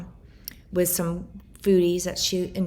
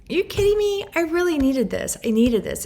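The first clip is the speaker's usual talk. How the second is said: much higher and louder, near tears; hearing her late mom's music at the gym; overwhelmed disbelief; 10 semitones higher, 5 dB louder than usual. Retold or imitated. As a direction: cry out in stunned gratitude.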